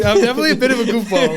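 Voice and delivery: high, loud and quick through laughter; amused, giggly confession; laughing admission. Real, not acted. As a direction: own up while cracking up. Laughing admission after being teased.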